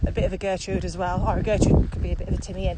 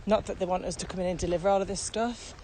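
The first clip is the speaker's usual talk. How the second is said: usual speaking voice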